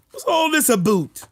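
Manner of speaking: high-pitched Canadian caricature; hammed-up Canadian accent; mock Canadian accent. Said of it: "Canadian acting tough".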